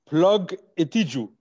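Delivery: a put-on African accent for the words Plug Itiju. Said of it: "switch into my, my African accent".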